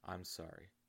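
ashamed quiet. A narrator's reading. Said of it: "The man felt ashamed".

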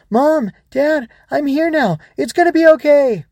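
put-on high, loud child's shout; calling out. Ava reaches her trapped parents, shouting to them. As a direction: cry out desperately to reassure them.

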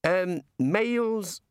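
slow, hesitant suggestion; tentative, slow; hesitantly venturing. A narrator's reading. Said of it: "he ventured".

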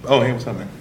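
ordinary delivery

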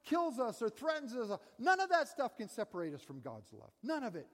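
high, loud fervent preaching; preaching at full force. Acted. Preacher driving home the Bible passage, loud and fervent. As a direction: preach it out to the whole room.

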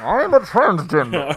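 Bane voice. Retold or imitated. Cohost mimics Naya doing a Bane voice, slow. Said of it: "she would do the Bane".

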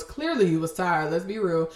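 ordinary talk, nothing marked

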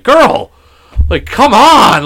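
loud, high mock exasperation; exasperated yell. Dad pranked by daughter playing dead, loud exasperated retelling. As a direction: cry out as if pranked once too often.

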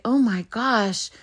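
ordinary delivery

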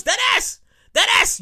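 high-pitched New York exclamation; excited New York slang voice; New York accent. Acted. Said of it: "In my New York voice".

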